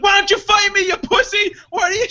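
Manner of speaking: very high mock shout; imitated yelling. Retelling the lady bursting back through the door, high mock yelling. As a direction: shriek the challenge. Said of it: "She's, like, yelling this".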